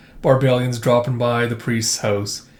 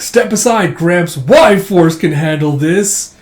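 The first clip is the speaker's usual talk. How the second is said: put-on loud, raised young hero's boast; cocky interruption; 4 semitones higher, 7 dB louder, slower than usual. Young hero pushes old Abraham aside, cocky and loud. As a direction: take over with a loud announcement.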